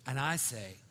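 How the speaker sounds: ordinary delivery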